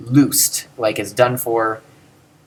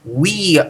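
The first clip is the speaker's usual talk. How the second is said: "We" held long and raised; emphasized WE; 4 semitones higher than usual. Shown as a demonstration. Teacher translating first person plural, emphatic "We". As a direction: lean hard on "We". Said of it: "we get to emphasize that mus, that we".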